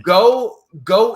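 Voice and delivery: a sudden loud high burst on the first word; a shouted opening to the final advice; emphatic shout. Real, not acted. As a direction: punch it out like a rallying cry. Climax of boiling podcast down.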